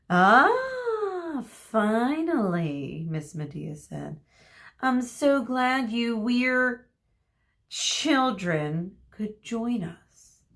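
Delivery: high, slow, syrupy teacher voice; sarcastic teacher. Acted. Miss Medea greeting latecomers, slow and mock sweet. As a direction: drawl the welcome with fake sweetness.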